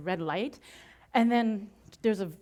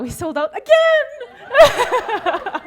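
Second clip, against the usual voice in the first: very slow, much higher and louder, laughing; triumphant; 13 semitones higher, 13 dB louder, much slower than usual. Real, not acted. Revealing to the audience the second sell-out, triumphant. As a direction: stretch it out in triumph.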